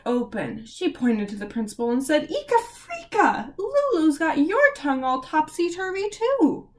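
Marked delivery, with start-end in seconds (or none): 2.3-6.7 high, squeaky flustered teacher; startled teacher voice pitched high; flustered Miss Bell. Acted.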